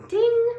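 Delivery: high, loud ding like an oven timer. A narrator's reading. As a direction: ring it out sharply.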